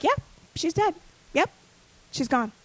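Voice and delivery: imitating an unmoved dad, matter-of-fact; zero reaction. Dad confirms his daughter's death to crying sibling, zero reaction. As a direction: drop it casually, like a dad who doesn't react. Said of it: "my father has zero reaction".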